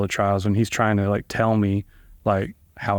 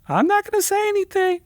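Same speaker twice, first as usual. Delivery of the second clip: much higher, sing-song coy voice; mock coy paralegal. Impression of the paralegal refusing to tell, high and coy. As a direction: tease like someone hiding a secret.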